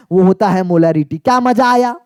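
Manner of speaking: ordinary delivery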